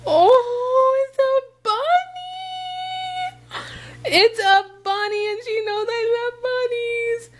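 high singsong gushing; delighted squeal. Real, not acted. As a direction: squeal with real delight at a surprise gift. Discovers a bunny on the gift.

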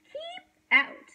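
very high, loud yell. A narrator's reading. Hank driving the tortoise away, yelling. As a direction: shriek it as a warning to trespassers. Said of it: "yelled Hank".

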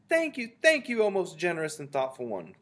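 very high, loud grateful witch voice; chorusing witches. A narrator's reading. Ancient Witches thanking their leader, high chorusing voice. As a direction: gush it high like fawning followers. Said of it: "chorused the Ancient Witches".

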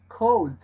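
"cold" loud, with a clear open O; "cold" given as the dictionary gives it, loud; dictionary pronunciation. Shown as a demonstration. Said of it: "entonces dice así".

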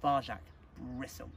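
usual speaking voice

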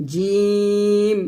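জিম stretched slowly; model for repeating. Shown as a demonstration. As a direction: say জিম long for students to echo. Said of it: "আমার সাথে পড়েন". (আমার সাথে পড়েন, জিম টেনে)

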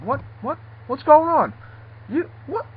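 high, confused stammer; startled awake. Acted. Sleeper woken by the boss, high startled confusion.

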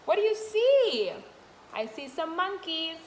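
ordinary talk

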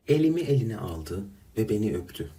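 usual speaking voice

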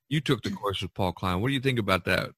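ordinary talk

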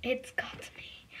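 breathy scared whisper. Pretend. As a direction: whisper as if hiding. Repeats it whispered.

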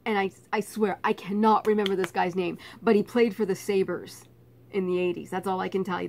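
ordinary talk, nothing marked

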